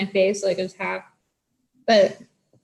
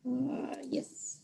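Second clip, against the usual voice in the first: whispered; 4 semitones higher, 13 dB quieter than usual. Real, not acted. Aside under breath.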